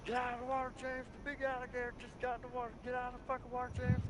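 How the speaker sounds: high, frantic shouted warning; frantic yell. Retold or imitated. Warning James out of the gator water, high frantic yell. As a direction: yell at your buddy to get out now. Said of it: "I'm yelling at James".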